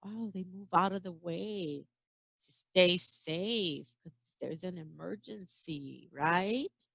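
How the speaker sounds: ordinary delivery, nothing marked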